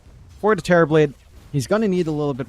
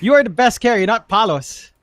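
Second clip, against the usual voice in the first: loud, high chat-message readout; mock proclamation; 7 semitones higher, 7 dB louder than usual. Caster reads out a player's chat boast, loud mock proclamation. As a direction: belt out the boast with raised pitch.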